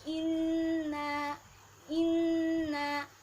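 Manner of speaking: inna loud with the nn pressed and hummed; pressed nasal inna. Shown as a demonstration. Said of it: "harus ditekan dan didengungkan".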